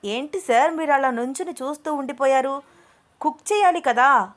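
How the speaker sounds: chirpy coaxing of the boss; lively teasing call to come cook; eager coaxing. A narrator's reading. Anu coaxing Abhi to cook.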